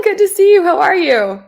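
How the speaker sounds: ordinary delivery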